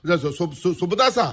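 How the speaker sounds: stuttering in fear on every syllable; can't get the name out for terror; frightened stammer. Acted. Scared man stammers at ghost. (భయంతో తడబడుతూ)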